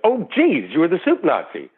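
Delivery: high-pitched retold "oh geez" of sudden realization; imitating a taken-aback director; startled recognition. Retold director recognizing the famous actor.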